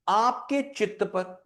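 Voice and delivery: slow, spaced-out dictation; deliberately slow. Shown as a demonstration. Teacher quoting Vivekananda for students to note, slowly. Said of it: "मैं बहुत धीरे धीरे बोलूंगा".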